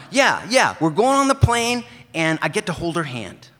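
high, loud, eager friend's voice; Brian's eager voice. Retold or imitated. Brian greets his mail carrier, high and loud. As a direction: pitch it way up and call out to your old buddy. Brian describes plane trip.

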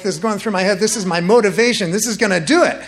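rising, building pep talk to self; psyching himself up. Retold or imitated. Player hyping himself before running into a huge opponent, psyched up.

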